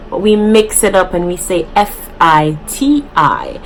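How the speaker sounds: ordinary delivery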